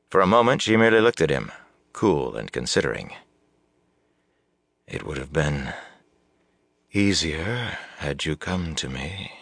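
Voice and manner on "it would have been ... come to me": soft, slow murmur. A narrator's reading. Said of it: "she murmured".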